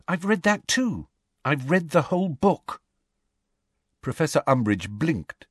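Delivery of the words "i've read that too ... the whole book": Hermione voice. A narrator's reading.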